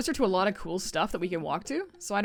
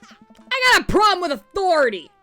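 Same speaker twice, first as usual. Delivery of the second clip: loud defiant yell, much higher; rebellious shout; 11 semitones higher, 13 dB louder than usual. Acted. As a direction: yell it like a punk. Daughter shouts rebelliously after kick.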